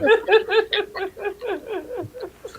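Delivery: long, high laugh trailing off; real laughter. Real, not acted. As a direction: crack up laughing at a jab. Laughing at the jab.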